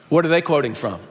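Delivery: ordinary talk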